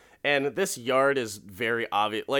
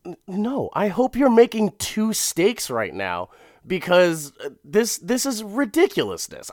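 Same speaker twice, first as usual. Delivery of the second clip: higher and louder, indignant protest; mock outrage; 9 semitones higher, 5 dB louder than usual. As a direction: protest like your steak was stolen. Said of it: "I'd be like".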